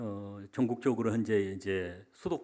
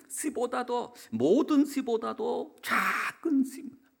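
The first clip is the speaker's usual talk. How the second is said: emphatic preaching; 9 semitones higher, 7 dB louder than usual. Real, not acted. Pastor expounding the mustard seed verse, high and forceful preaching. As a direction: preach it with lifted, swelling emphasis.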